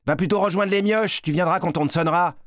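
high, fast, scornful jeer; rapid, high-pitched teasing with disdain; Yvon mocking. A narrator's reading. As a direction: taunt a younger boy and send him packing. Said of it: "Yvon ne rate jamais une occasion de se moquer".

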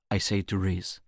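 usual speaking voice

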